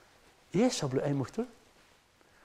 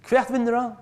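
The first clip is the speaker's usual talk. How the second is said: raised question; 9 semitones higher, 5 dB louder than usual. Shown as a demonstration. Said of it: "Det er også interessant".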